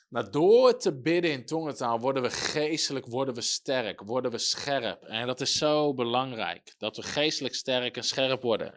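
loud, driving sermon voice with heavy stresses; preaching at full force. Acted. Pastor urging listeners to pray in tongues, preaching loudly. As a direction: hammer the point home from the pulpit.

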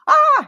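sharp high yelp of fright; startled yelp. Retold or imitated. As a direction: yelp as he lunges for you. Startled by attacker grabbing bib.

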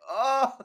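villain scream. Acted. Character screams in anime scene.

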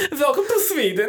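put-on high sing-song Swedish accent, laughing; lilting Swedish welcome. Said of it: "You need your best Swedish accent".

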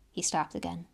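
ordinary delivery, nothing marked

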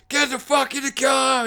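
very high, strained mock-psycho order; hammed-up furious command; so angry. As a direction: imitate a man in a crazed rage. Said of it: "just so angry now".

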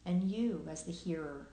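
unhurried meditation guiding with long pauses; guided meditation. Acted. Host guiding listeners into present awareness, calm meditation voice with long pauses. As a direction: lead listeners gently through their senses, leaving long silences.

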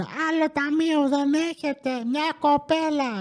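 high, slow, put-on nagging voice; mocking impatient shopper. Impatient shoppers nagging the staff at the checkout, mocked high and slow. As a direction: stretch it out like a fed-up customer.

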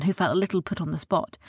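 ordinary delivery

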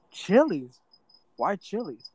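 high-pitched incredulous echo; acted bewilderment, voice shooting up; baffled retold question. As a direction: repeat it in mock disbelief, pitch jumping. Retold bafflement at search reason.

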